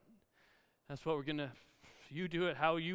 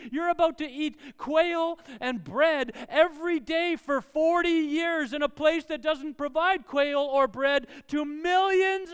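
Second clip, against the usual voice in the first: loud, high, impassioned sermon; full-force preaching; 9 semitones higher, 12 dB louder than usual. Acted. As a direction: preach it out to the whole crowd.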